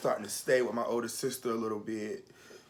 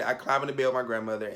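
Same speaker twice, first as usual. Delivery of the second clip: ordinary talk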